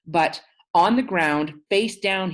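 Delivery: slow loud cop order; harsh police command. Acted. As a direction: bark it at the man on the ground.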